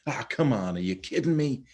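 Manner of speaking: high, loud exasperated cry skyward; exasperated complaint. Retold or imitated. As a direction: throw up your hands at the sky, fed up. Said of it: "you look up and you go".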